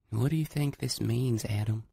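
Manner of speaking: put-on bright young Southern girl teasing; lightly voiced girl. A narrator's reading.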